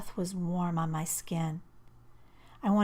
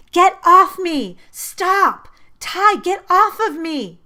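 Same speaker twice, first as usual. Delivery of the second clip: high, loud screaming to get off; desperate yell; 12 semitones higher, 16 dB louder, slower than usual. A narrator's reading. Girl yelling at boyfriend to get off her, full scream. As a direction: scream it, fighting him off. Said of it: "I yelled at him".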